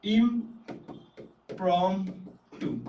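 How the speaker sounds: drawn-out demo. Shown as a demonstration. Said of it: "আরেকটা synonym হচ্ছে ইমপ্রম্পট".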